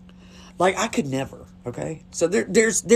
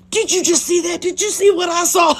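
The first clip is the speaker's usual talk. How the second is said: much higher, louder, thrilled amazement; excited exclamation; 12 semitones higher, 5 dB louder than usual. Retold or imitated. Teen reacting to what she just saw, thrilled exclamation. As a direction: burst out as if it's an incredible spectacle.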